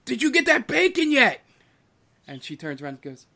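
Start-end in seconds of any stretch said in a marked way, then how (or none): 0.1-1.4 high, loud kitchen yell; yelling cook. Acted. Cook yelling at the bartender about bacon, high-pitched yell. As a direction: holler it high across the room. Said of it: "who is yelling out at her".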